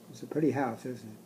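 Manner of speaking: usual speaking voice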